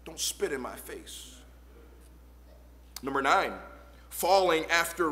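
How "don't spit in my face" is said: much softer, low repeat; soft echo. Acted. Preacher repeating God's rebuke, softly. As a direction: drop to a hush.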